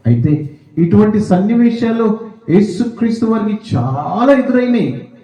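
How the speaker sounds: high, ringing pulpit voice rising to a climax; preaching at full force. Acted. Pastor exhorting the church, preaching at full force. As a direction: preach it out to the congregation.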